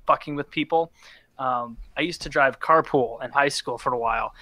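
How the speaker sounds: usual speaking voice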